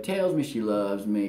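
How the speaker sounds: usual speaking voice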